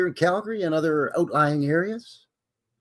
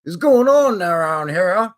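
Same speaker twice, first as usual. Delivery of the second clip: folksy exclamation; 5 semitones higher, 7 dB louder than usual. Retold or imitated. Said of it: "they could say".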